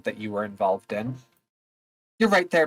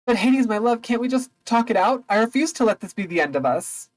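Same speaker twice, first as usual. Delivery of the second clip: higher, pleading goddess; pleading Persephone; 9 semitones higher than usual. Acted. Persephone pleading with Hades, higher and begging. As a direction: beg him to talk, pitched up.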